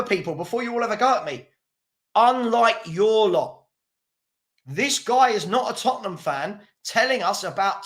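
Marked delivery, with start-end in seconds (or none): 2.1-3.6 slow, drawn-out "unlike your lot"; mocking stress on unlike. Shown as a demonstration. Quoting the troll back slowly. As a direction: drag out "unlike" with scorn. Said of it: "please remember".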